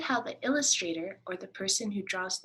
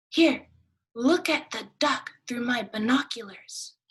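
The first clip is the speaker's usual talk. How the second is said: higher, louder duck; eager duck; 4 semitones higher, 7 dB louder than usual. Acted. Duck offering binoculars, eager. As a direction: call it out proudly.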